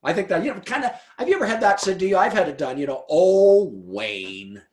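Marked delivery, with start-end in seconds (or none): 3.1-4.6 a wife's weary scolding sigh at her husband; exasperated "Oh, Wayne". Retold or imitated. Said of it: "my wife ... does that a lot".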